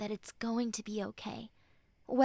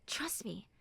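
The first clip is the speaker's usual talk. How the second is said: a low whispered aside; 4 semitones lower, 10 dB quieter than usual. Acted. Friend whispering to the listener to trust them. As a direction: lean in and whisper it. Comforting a friend, soft intimate.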